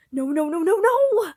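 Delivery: loud, rising to a high scream; panicked denial. Acted. Pat finding Roman bleeding, screaming in denial. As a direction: scream no in horror.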